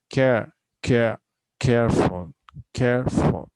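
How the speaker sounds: "careful" with a punched CARE; stress on first syllable. Shown as a demonstration. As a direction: hit "care" hard, then a lighter "ful" in "careful". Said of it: "stress is on the first syllable".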